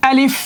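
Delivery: "আলিফ" said short and crisp with a clear final f; letter drill. Shown as a demonstration. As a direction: model "আলিফ" for the class to repeat. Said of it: "আমার সাথে সাথে বলতে হবে".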